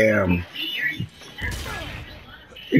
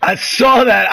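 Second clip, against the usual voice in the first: excited; 7 semitones higher, 4 dB louder than usual. Real, not acted. Excited reaction in firefight.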